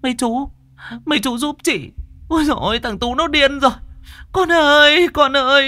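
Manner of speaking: very high, loud wailing cry for help; frantic shriek. Acted. Mother shrieking to the butchers for help with her son. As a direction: scream for help in panic. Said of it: "Bà Nụ kêu váng".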